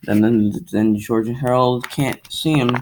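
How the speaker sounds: usual speaking voice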